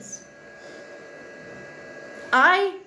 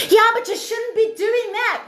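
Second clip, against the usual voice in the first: higher, louder scolding mock voice; mock critic; 6 semitones higher, 4 dB louder than usual. To viewers, mimicking a scold who disapproves. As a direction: nag like a prim critic. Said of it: "people say".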